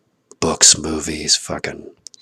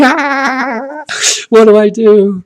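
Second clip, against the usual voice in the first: exasperated wail; 8 dB louder, much slower than usual. Real, not acted. Comic exasperation after laugh.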